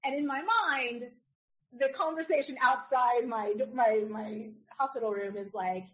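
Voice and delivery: ordinary talk, nothing marked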